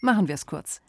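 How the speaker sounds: plain reading voice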